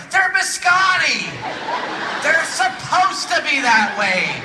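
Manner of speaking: slow, loud, high heckler's yell. Retold or imitated. People up front correcting the speaker about cookies, indignant yelling. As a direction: holler each word across the hall. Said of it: "they're yelling up front".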